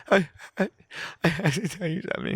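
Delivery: high, quavering mock crying; halting, high-pitched weepy impression; imitated tearful confession. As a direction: imitate someone struggling to confess through tears. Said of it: "just starts crying".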